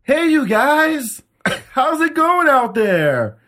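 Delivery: high, loud, drawn-out "Hey, you guys"; mock the singer's corny crowd greeting; cheesy band banter. Mocking the band's singer greeting the crowd, high and cheesy.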